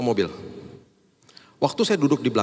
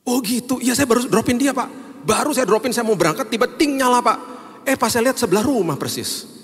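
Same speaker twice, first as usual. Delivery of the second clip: high, bubbly driver voice; excited driver; 11 semitones higher, 3 dB louder than usual. Retold or imitated. Driver explaining his quick arrival, excited and high. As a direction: tell it with eager wonder.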